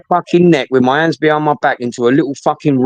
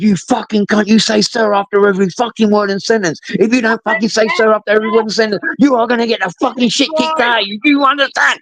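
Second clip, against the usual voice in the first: put-on high, furious officer yelling abuse at a naked new boy; raging guard, pitch climbing higher to the end; screaming prison officer; 9 semitones higher than usual. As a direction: bark every word at him like a drill screw.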